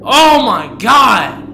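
slow, loud drawn-out cry; stretched shout. Real, not acted. Fan overwhelmed by [name], shouting slowly. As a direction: let it burst out long and loud.

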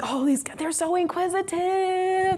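high, slow gushing over her students; lightly voiced naive delight; naive gushing self.

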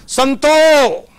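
loud high call across the house; husband calling for his wife, loud call. Retold or imitated. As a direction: call her name from the doorway.

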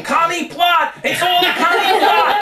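high-pitched mock screaming protest; shrill-high comic rant; kicking and screaming. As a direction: holler it like you're being dragged out. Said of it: "left kicking and screaming".

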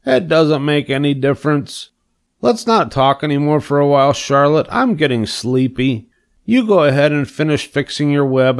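drowsy pig settling down for the evening; sleepy pig Wilbur. Acted.

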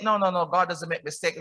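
ordinary talk, nothing marked

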